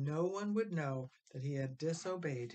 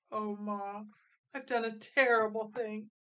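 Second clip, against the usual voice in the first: higher, louder, on the verge of tears; distressed confession; 5 semitones higher, 5 dB louder than usual. Acted. Frankie confessing to his mother, near tears.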